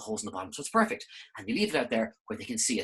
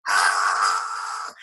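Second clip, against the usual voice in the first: straining cry; 9 semitones lower, 6 dB louder than usual. Acted. Flower straining.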